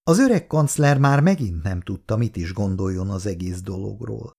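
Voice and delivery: usual speaking voice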